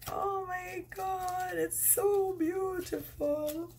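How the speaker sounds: high, soft, slow gasp of wonder; awed delight. Real, not acted. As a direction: breathe it out, overwhelmed by beauty. Moved by the tarot card.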